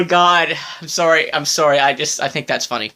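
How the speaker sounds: usual speaking voice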